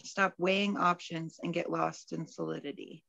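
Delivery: usual speaking voice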